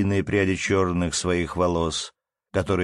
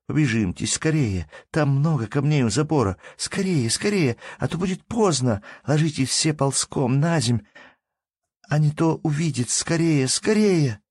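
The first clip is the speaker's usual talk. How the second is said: higher, pressing urgency; urgent hurrying; 9 semitones higher than usual. Acted. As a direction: hurry them along anxiously. Girl urges children to hurry.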